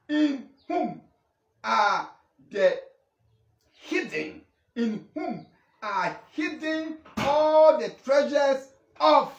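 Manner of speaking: slow, emphatic preaching; declaimed scripture. Real, not acted. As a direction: proclaim the verse, stretching the key words.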